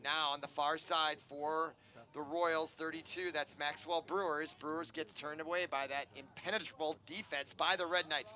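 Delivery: normal talking voice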